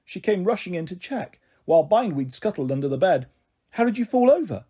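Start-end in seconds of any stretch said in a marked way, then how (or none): 3.7-4.6 higher-pitched, mildly worried question; anxious. A narrator's reading. Mum checking on fallen daughter, anxiously. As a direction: ask with motherly concern. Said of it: "Mum asked anxiously".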